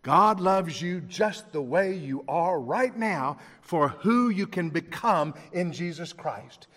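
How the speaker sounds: slow and deliberate, every word weighted; slow emphatic repeat. Real, not acted. Preacher repeating to the congregation, slow and deliberate. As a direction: say it again slowly so it sinks in. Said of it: "I want to say that to you again".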